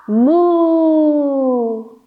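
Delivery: long playful moo; cow moo. Shown as a demonstration. Teacher moos again for cow pose, playful. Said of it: "for cow".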